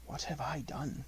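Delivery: soft, fawning murmur. Acted. Panther fawning on Mowgli for praise, soft and coaxing. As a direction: fawn on him, coaxing and quiet.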